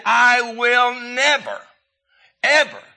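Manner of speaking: loud, high, slow declaration; emphatic vow. Retold or imitated. Preacher quotes God's promise after the flood, emphatic vow. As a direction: proclaim it, drawing out "never" and punching "ever".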